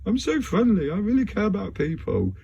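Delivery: high, sugary overfriendly voice; sweet fake-friendly persona; friendly alter ego. Shown as a demonstration. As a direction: play the nice side of a split personality. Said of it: "Like, this is".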